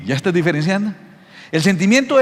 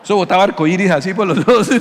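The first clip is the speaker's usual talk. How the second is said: normal talking voice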